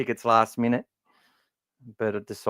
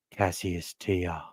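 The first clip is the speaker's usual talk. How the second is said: slow, much softer, low murmur of a name; ASMR-style murmur; 5 semitones lower, 9 dB quieter, much slower than usual. Shown as a demonstration. Podcaster murmuring a junior's name ASMR-style. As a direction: savour the name, slow and hushed. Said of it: "What do they call that? ... A S M R?".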